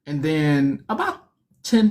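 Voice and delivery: usual speaking voice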